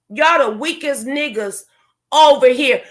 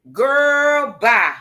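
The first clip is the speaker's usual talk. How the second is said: drawn-out dismissal. Real, not acted.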